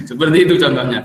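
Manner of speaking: laughing. Real, not acted. Teacher amused at his advert-like example, laughing. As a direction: laugh your way through the remark.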